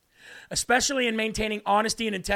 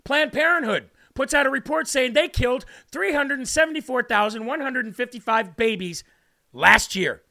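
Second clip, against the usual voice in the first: ordinary talk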